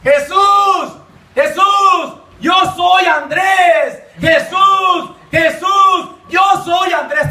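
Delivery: high, drawn-out calls to Jesus; imitated crying out. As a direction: call out as the drunk man at the altar. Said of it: "le gritaba".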